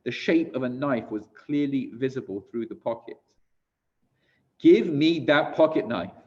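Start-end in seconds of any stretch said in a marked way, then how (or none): 4.6-6.1 loud, higher, forceful order; commanding voice. A narrator's reading. Woman orders the German to hand over his knife, commanding voice. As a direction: order it with full authority. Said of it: "in a commanding voice".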